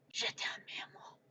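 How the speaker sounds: very soft, breathy whisper; whispered love declaration. Acted. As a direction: whisper sweet nothings in French. Same French love declaration, whispered.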